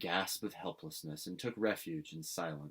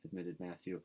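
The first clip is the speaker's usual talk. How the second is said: usual speaking voice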